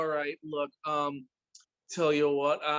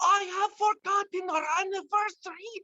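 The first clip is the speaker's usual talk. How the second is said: much higher, joyful German husband; ecstatic; 11 semitones higher, 4 dB louder than usual. Acted. Husband admits he forgot, in high ecstatic delight. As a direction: squeal it out in delight. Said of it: "ecstasy. So very happy".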